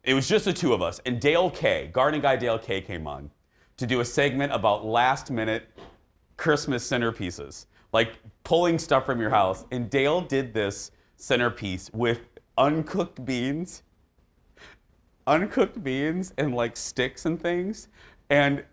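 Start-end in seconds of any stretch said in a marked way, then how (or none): none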